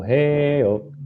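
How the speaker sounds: high sung-out call. Retold or imitated. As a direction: belt the crowd callback up high. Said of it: "that callback thing he was doing".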